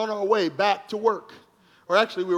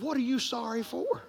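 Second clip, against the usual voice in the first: much softer, puzzled question; soft puzzled reply; 11 dB quieter than usual. Retold or imitated. Pastor answering the officer, softly puzzled. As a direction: ask it gently, baffled. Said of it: "I said".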